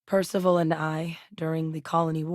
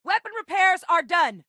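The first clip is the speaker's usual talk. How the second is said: high-pitched shipboard shout; urgent status callout; 13 semitones higher, 4 dB louder than usual. Acted. Crew member reporting repairs to captain, urgent high callout. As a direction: call it out over a battle, pitch way up.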